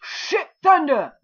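sudden loud high yell of shock; startled shout. Real, not acted. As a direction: yell it out, caught off guard. Sudden thunder strike in game.

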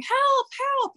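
high-pitched mock yelp for help; imitated cries for help. Girls calling out on court, imitated high yelling. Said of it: "the girls are yelling".

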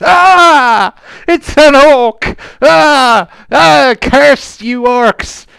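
high, very loud pirate shrieks, drawn out; panicked, full-voiced wailing and cursing; horrified scream. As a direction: scream in terror at the orc. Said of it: "she screams in horror".

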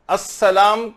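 ordinary talk

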